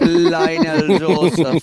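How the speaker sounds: loud, high, slow name call; Jamaican accent. Retold or imitated. Echoes the name with relish.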